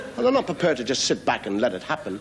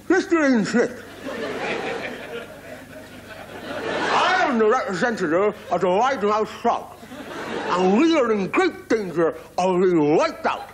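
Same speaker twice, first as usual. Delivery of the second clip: very high, stretched wide-mouth frog voice; 10 semitones higher than usual. Acted. Frog spokesman pleading with Ian Smith, in a high wide-mouthed voice. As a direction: plead like a pompous little frog with a huge mouth.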